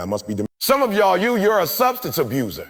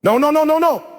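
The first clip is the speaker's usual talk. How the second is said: rapid-fire noes, high and loud; telling your sick self no, urgently; emphatic self-refusal; 10 semitones higher, 4 dB louder than usual. Acted. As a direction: scold yourself with a string of high, forceful noes. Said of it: "tell your sick self, no".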